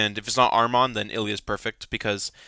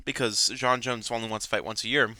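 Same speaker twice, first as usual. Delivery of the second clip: ordinary talk, nothing marked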